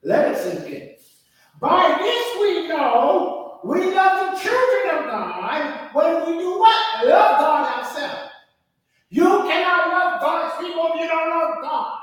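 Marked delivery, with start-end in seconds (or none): 1.6-8.3 slow, louder reread of the verse; preaching it a second time; preached reread. Shown as a demonstration. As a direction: let it sink in slowly. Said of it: "Let me read it one more time".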